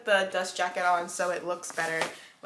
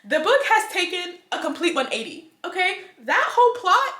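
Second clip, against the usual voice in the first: excited rant; 7 semitones higher, 6 dB louder than usual. Real, not acted. Excited rant on plot twist.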